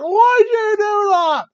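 high, loud, drawn-out Aussie wail; badly faked Australian accent, upset and screechy; mock Aussie accent. Upset Billie Kay confronts her partner, mock Aussie accent. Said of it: "can't even speak like an Aussie".

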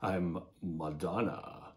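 light mock highfalutin British accent, slowing at the end; mock posh British. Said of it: "adopted a highfalutin British accent".

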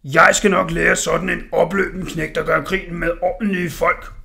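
loud bellowing smith; roaring. Acted. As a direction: roar it at the kid. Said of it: "brølede smeden".

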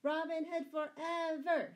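high, loud shouted acclaim; crowd's cheer. A narrator's reading. Townsfolk cheering the departing outlaws, shouting. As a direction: cheer the hero with full voice. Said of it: "shouted the crowd".

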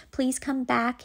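ordinary talk

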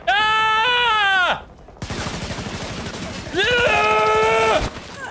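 long, high-pitched held scream. Real, not acted. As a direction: scream on one sustained high note. Screams during gameplay.

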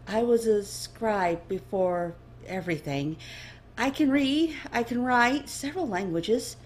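eager, rising nervous answer; nervous young elf. Acted. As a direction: list skills anxiously.

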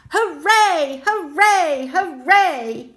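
put-on loud, high hooray for kids to copy; cheer. Shown as a demonstration. Narrator inviting listeners to join, loud cheer. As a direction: lead the children in a cheer. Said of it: "Can you join in?".

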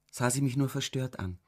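ordinary delivery, nothing marked

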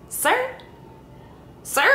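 very high, stunned disbelief; incredulous sir. Retold or imitated. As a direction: squeak it out in shock. Incredulous reply to doctor.

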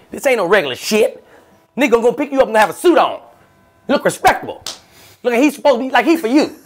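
high, loud hustling chauffeur; eager driver voice. Retold or imitated. SUV driver to rider, high loud eager hustle. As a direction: sell the luxury service hard.

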